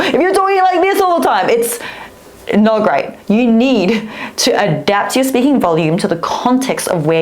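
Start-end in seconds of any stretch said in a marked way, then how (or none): 0.0-1.5 much higher, pushed loud voice. Shown as a demonstration. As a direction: blast it out too loud.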